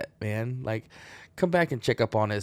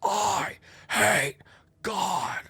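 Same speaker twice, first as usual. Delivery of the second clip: much higher, strained mock bark; imitating a loud rant; 10 semitones higher, much slower than usual. Retelling the stranger's street rant, high mock bark. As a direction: parrot the street ranter's bark. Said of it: "barking it, like, really loud".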